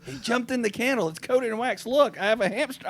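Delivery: high, panicked retelling of the wife; wife breaking bad news in a panic. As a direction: raise the voice like a worried wife reporting. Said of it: "She goes".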